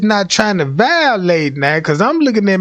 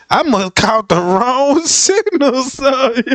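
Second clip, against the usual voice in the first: laughing; 5 semitones higher, slower than usual. Real, not acted. Laughs at own mistake.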